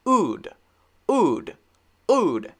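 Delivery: "ood" from the throat, lips doing nothing; wrong W again. Shown as a demonstration. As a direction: drop the W and say a bare throaty "ood". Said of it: "also do this".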